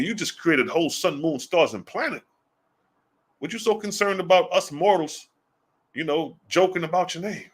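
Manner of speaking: high-pitched, half-playful challenge to God; mock incredulous.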